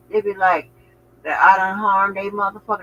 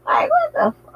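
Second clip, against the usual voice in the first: incredulous; 3 dB louder than usual. Real, not acted. Incredulous outburst about haters.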